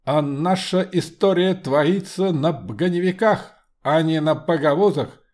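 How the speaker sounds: loud commanding metallic voice; steely voice. Acted. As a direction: shout down the crowd like an iron automaton. Homunculus interrupts.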